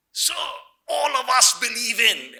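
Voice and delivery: loud, slow, indignant shout; righteous protest. Retold or imitated. Teenage girl protesting to the headmaster, loud indignant shout. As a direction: storm in and demand justice, loudly. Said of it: "righteous anger and full of justice".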